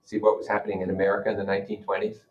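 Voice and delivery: usual speaking voice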